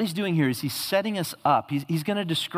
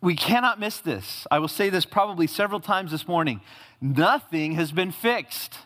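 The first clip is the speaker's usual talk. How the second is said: ordinary talk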